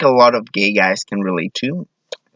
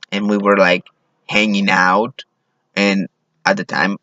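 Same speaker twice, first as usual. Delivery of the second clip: ordinary talk, nothing marked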